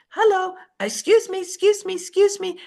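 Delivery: high, breezy voice squeezing past people; high breezy latecomer squeezing past; pushy latecomer. Retold or imitated. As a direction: chirp "excuse me" while pushing through. I types pushing in late.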